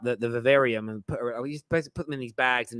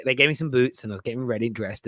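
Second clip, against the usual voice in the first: ordinary talk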